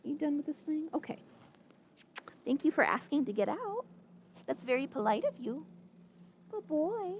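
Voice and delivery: high, bright-voiced praise to a pet; doting pet talk. Real, not acted.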